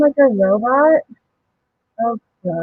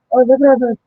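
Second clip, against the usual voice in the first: ordinary delivery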